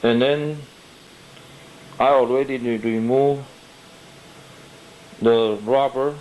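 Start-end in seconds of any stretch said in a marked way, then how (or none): none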